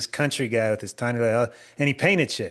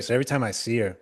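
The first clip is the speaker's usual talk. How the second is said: ordinary talk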